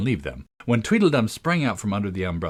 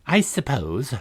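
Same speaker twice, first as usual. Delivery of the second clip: lifted, grudging agreement; mildly petulant character voice; sulky; 5 semitones higher, slower than usual. A narrator's reading. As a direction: concede it in a sulk. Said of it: "the other sulkily replied".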